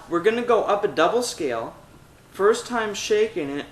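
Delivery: normal talking voice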